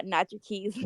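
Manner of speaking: ordinary delivery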